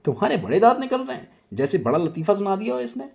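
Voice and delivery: high, fast irritated snap; peeved snap. Acted. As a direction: snap at him, embarrassed and cross. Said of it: "गुस्से में ... खिसियाते हुए कहती है".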